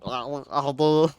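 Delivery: slow "I'll do" from the throat like a French hello; slow, throaty try at a French greeting; attempted French accent. Shown as a demonstration. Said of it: "I keep trying to use my throat".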